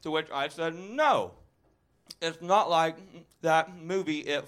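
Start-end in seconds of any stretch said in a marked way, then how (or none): none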